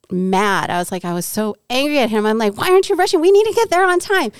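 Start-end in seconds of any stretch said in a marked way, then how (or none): 2.6-3.2 high, loud, fast angry nagging; angry rushing. Retold or imitated. Angry wife rushing husband, retold. 3.2-4.3 urgent yell. Retold or imitated. Repeated urgent demand.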